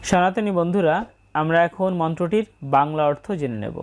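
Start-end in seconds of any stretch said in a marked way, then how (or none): none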